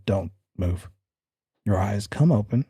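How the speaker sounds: normal talking voice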